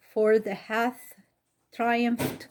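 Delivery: plain reading voice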